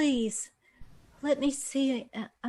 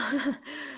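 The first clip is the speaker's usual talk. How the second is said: soft laugh; 2 semitones higher, 6 dB quieter than usual. Acted. Patient delights in her beauty.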